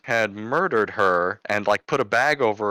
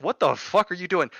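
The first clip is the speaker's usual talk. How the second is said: imitating a bewildered kid, high-pitched; high, incredulous question; mock disbelief, voice pitched up; baffled; 9 semitones higher than usual. Retells the baffled kid's reaction.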